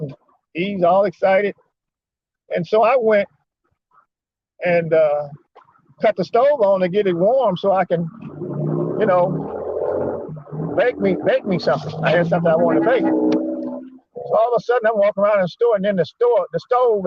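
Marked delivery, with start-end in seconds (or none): none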